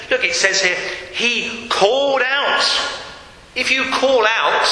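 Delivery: ordinary talk, nothing marked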